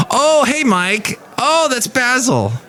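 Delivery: very high, mock-startled greeting; awkward surprised recognition. Father-in-law suddenly recognizing his son-in-law, awkwardly surprised. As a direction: act caught out, pitch jumping way up.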